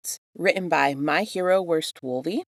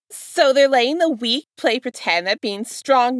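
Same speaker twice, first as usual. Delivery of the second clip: villain taunt; 8 semitones higher, 7 dB louder than usual. Acted.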